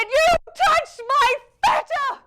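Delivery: high, loud, slow outraged cry; furious mum. Retold or imitated. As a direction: shriek it like a betrayed mother. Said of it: "she said".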